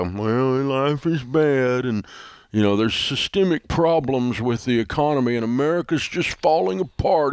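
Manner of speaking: comic doom-and-gloom whine; pickle-sour negativity. Acted. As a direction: wallow in mock self-pity, stretching the words. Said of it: "You sound like the guy's had a pickle".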